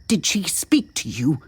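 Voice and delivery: insistent questioning; demanding. A narrator's reading. Distraught man questions his companions, demanding. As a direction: press for an answer. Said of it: "Jude demanded".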